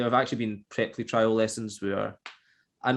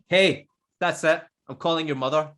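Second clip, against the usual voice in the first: retold stern threat; 5 semitones higher, 5 dB louder than usual. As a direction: act out a teacher who has had enough. Retold telling-off of misbehaving pupil.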